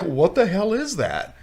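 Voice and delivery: high, drawn-out baffled outcry; mock bewilderment. Voicing clueless zombie-movie characters.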